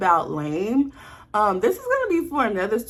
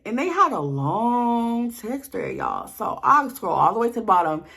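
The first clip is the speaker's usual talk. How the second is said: normal talking voice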